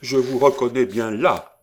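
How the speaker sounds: high, loud, rushed exclamation; exasperated outcry. A narrator's reading. Old lady recognising the girl's lineage, exasperated outcry. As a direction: burst out fast and high in indignation. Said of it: "s'écria la Desroches".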